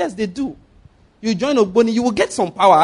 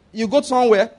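ordinary talk

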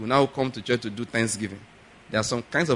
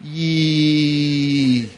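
mock shocked yee; 4 dB louder than usual. Reaction to helping politicians.

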